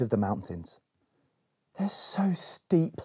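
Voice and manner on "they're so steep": whispered, slow, out of breath from the climb; puffing. A narrator's reading. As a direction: puff the words between breaths. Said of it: "puffed Berry".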